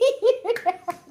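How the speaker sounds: high burst of laughter; amused giggling laugh; real laugh. Real, not acted. Laughs at her memory.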